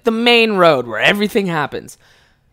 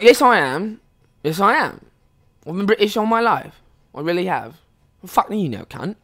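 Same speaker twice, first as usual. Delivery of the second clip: put-on British accent, insisting; 2 semitones higher than usual. Fake Brit answers a doubting partygoer, insistent British accent. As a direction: defend yourself in a mock British accent. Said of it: "we were actually British".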